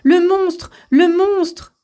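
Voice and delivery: breathless alarm, shout it out; screaming. A narrator's reading. Court lady bursts in warning the queen, screaming. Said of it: "en hurlant".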